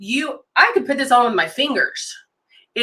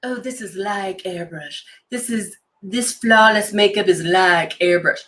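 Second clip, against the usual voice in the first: mock quote. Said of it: "all the times you've heard".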